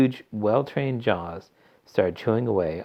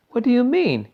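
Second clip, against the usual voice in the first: put-on puzzled girl voice; 6 dB louder than usual. A narrator's reading. Violet puzzled.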